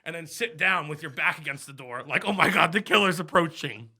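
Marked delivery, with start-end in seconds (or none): 2.3-3.9 mock horror-movie panic. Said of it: "I'm talking horror movie".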